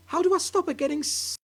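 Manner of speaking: high, anxious cry; cried out. Acted. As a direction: cry out in helpless worry.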